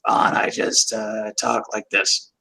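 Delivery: rasping near-whisper, low and slow; damaged voice. Retold or imitated. Dean explaining his injury in his damaged voice. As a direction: push the words through a crushed larynx. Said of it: "And so he just goes ... talk like like this".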